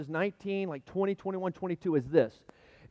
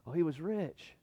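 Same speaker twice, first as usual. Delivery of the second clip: softer, rueful aside; rueful sigh; 8 dB quieter than usual. Real, not acted. Pastor sighs over the rich man, softly. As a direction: say it with quiet regret.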